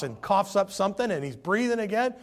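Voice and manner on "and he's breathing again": ordinary talk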